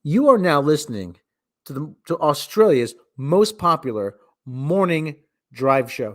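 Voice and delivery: loud announcer intro; loud, slowed radio promo read. Acted. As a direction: sell it like a morning radio host.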